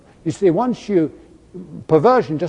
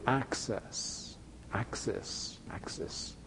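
access, access, access breathed out, much softer; mocking whisper; 4 semitones lower, 18 dB quieter, much slower than usual. Preacher mocking the European Union, whispered. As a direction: whisper it like a sly repeated demand.

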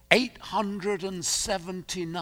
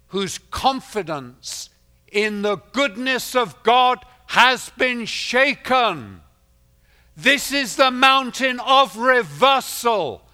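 loud, high, ringing sermon voice; rising pulpit proclamation; full-force preaching; 7 semitones higher, 10 dB louder than usual. Acted. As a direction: preach it out to the whole hall.

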